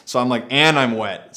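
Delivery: ordinary talk, nothing marked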